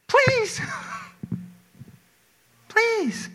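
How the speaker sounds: very high-pitched begging please; pleading. Acted. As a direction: beg in a tiny, high voice. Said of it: "We beg and plead God".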